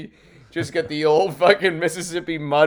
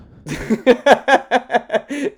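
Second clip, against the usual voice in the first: high burst of laughter; loud laugh; 9 semitones higher, 9 dB louder than usual. Real, not acted. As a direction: cackle out loud. Big laugh at the joke.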